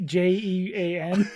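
slow, letter by letter; spelling it out. Shown as a demonstration. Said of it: "Oxy and then G".

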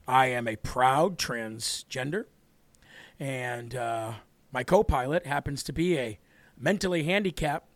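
low, deep put-on pilot voice; mock airline captain. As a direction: drop the voice low for a mocking cabin announcement. Mock pilot cabin announcement.

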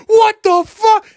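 very high, loud, slow mock outrage; outraged shriek. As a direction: screech it in disbelief. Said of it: "I'm just like".

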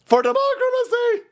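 high, loud hammed-up rallying cry; mock battle cry. Host mocking lefties with a high mock battle cry. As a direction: shout it like a parody hero.

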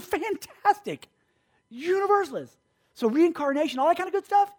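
over-the-top enthusiasm. Retold or imitated. Preacher greeting the stranger's belief on the subway, hugely enthusiastic. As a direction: gush as if it's wonderful news.

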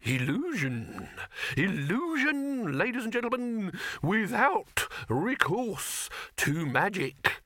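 high, slow grand showman. Acted. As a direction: proclaim it like a carnival barker. Showman Silverfish announcing.

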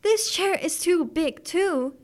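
high, slow, drawn-out whine; whining. A narrator's reading. Goldilocks trying the second chair, whining slowly. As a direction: whine it like a fed-up little girl. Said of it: "she whined".

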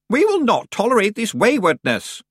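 an offended aunt banging at the door; stern aunt. A narrator's reading.

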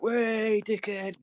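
high-pitched crowd jeer; mock jeering cheer. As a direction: imitate a cookhouse jeering a dropped tray. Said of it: "instantly everyone's like".

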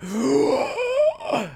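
sharp high gasp coming up from the water; desperate gasp for air. Retold or imitated. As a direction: gulp air like surfacing from drowning. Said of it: "went like that".

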